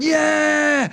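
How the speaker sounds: high, drawn-out cheer; acted roaring applause shout; crowd cheer. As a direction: cheer like a whole audience.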